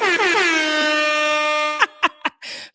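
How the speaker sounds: long high cackle; loud laugh. Real, not acted. After teasing nosy viewers, long loud laugh.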